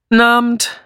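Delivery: abrupt, gruff evening greeting. A narrator's reading. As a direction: throw out a blunt hello. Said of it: "sagte sie barsch".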